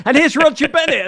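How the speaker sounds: high, bright-pitched imitation of a TV host's intro; mock host introduction. Hosts bring on the soccer guest after the economy, mock announcing.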